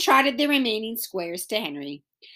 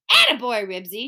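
plain reading voice

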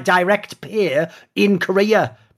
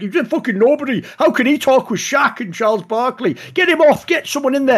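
higher, indignant fan rant; mocking pleb rant; 6 semitones higher than usual. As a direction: scoff it like an outraged armchair fan. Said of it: "The pleb take goes like this".

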